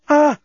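short, high, loud "ah"; passionate moan. A narrator's reading. Lucy moaning to the journalist, passionate cry. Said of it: "repeated Lucy".

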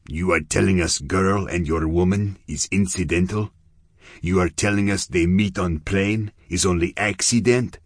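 menacing Russian gangster, clipped English; lightly voiced Russian heavy pressing for answers; a hint of a Russian accent, suspicious. Russian gangster Yevgeny speaks.

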